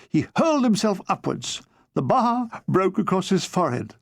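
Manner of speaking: plain reading voice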